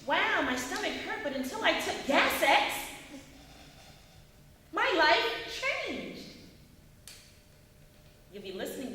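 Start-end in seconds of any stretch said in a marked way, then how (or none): 0.0-6.2 loud, high testimonial with big swings; high-pitched commercial read. Shown as a demonstration. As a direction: sell it like an amazed TV customer. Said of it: "is on a high pitch".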